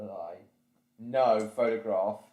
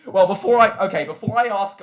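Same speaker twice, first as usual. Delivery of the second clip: loud, excited; 9 semitones higher, 11 dB louder, faster than usual. Real, not acted. Sudden loud restart.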